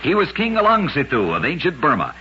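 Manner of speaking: ordinary delivery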